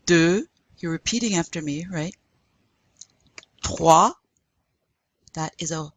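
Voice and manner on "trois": "Trois" with a throaty wet R; loud, rasped R in "Trois"; wet French R. Shown as a demonstration. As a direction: gargle the R in "Trois". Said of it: "wet R".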